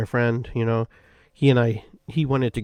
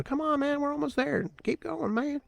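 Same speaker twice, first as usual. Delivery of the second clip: much higher, cheering him on; encouraging hikers. Retold or imitated. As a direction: urge him upward in a high, cheering voice. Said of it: "encouraging me to go".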